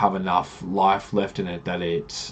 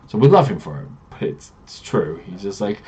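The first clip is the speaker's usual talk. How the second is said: ordinary talk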